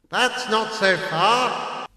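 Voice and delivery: high, slow cheerful reassurance; cheerful uncle. A narrator's reading. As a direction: cheer the lost boy on. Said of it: "my uncle said cheerfully".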